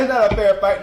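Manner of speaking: usual speaking voice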